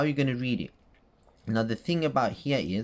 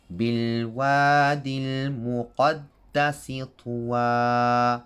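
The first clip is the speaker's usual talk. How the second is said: تلاوة القرآن; Quranic recitation. Shown as a demonstration. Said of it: "recites again".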